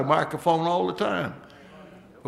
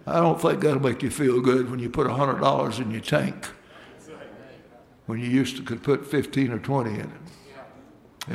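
ordinary talk, nothing marked

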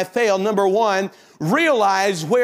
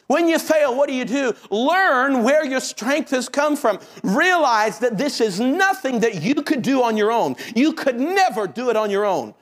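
high, driving sermon delivery; impassioned preaching; 6 semitones higher than usual. Real, not acted. Preacher urging the congregation to their task, high and driving. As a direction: press each point home in a raised pitch.

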